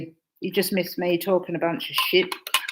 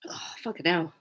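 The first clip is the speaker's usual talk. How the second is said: whispered; 6 dB quieter than usual. Real, not acted. Sore throat after coughing.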